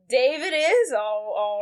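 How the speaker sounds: normal talking voice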